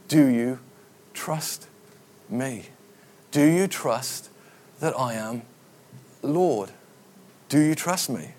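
soft, spaced word by word; quiet earnest appeal. Acted. God asking the congregation for trust, quiet and earnest. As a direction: let it drop almost to a whisper, with long pauses. God's question put to congregation.

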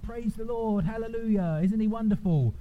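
high-pitched mock-pious church voice; church accent. Mocking someone who switches on Sunday, in a church accent. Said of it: "develop a church accent".

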